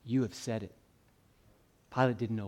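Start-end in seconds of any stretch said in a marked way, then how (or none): 0.0-0.8 low, soft reply to a questioner; hushed, understated king's answer; quiet answering king. Acted. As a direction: answer quietly, letting the pitch sink. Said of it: "And he'll say".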